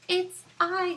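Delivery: high, loud squeaky fly; a tiny fly answering proudly. Acted. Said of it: "said the fly".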